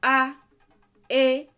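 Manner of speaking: each vowel called out high and loud with pauses; vowel drill. Shown as a demonstration. Teacher reciting the seven vowels for learners to repeat. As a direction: call out each vowel clearly for the class.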